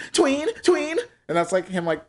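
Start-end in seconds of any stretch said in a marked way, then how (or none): 0.1-1.0 high hyped-up commentator call; imitating sports commentator. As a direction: mimic a hyped sports reaction guy. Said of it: "the guy's always just like".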